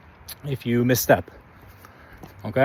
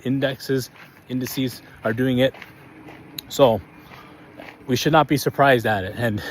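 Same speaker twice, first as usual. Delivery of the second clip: normal talking voice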